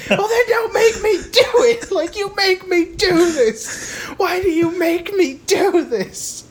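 very high, slow mock sob-yell; hammed-up crying shout. Mother wailing at her son that he makes her do this, hammed-up sobbing. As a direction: wail it like a melodramatic mom. [name]'s hysterical wail.

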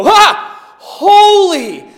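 high, loud cry of awe; overwhelmed angel crying out; a loud, high worshipful holy; awestruck cry. Acted. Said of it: "they look up at God and they go".

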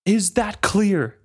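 high, slow, drawn-out angry demand; bellow. A narrator's reading. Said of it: "Brashen bellowed".

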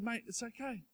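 soft, gentle reassurance; calm the crying child down; a parent comforting a hurt kid, soft and calm; soothing a hurt kid. Retold or imitated. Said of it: "I picked them up and I said".

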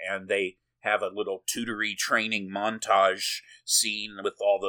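usual speaking voice

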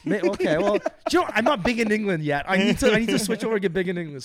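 playfully defensive after a friend's tease, loud and high; laughing protest. Real, not acted. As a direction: laugh off the jab and push back.

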